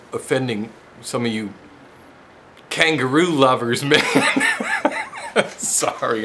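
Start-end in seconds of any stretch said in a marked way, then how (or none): 4.0-5.5 very high squealing laugh. Real, not acted. Laughing at kangaroo lovers joke.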